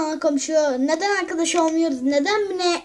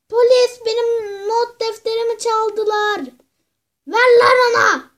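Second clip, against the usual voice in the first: angry yell; 4 semitones higher, 5 dB louder than usual. Acted. Calling police, yelling at neighbor.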